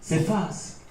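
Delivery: high pitch, fading from full voice to very soft; fading away. A narrator's reading. Poet on time slipping away, fading out. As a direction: let each repeat vanish further.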